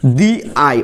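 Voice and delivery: দি আই with "the" said "dhee". Shown as a demonstration. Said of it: "তাহলে এটা উচ্চারণ কি হবে". (দি আই, "দি" ই-কার দিয়ে উচ্চারণ)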